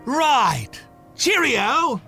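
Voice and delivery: ordinary talk, nothing marked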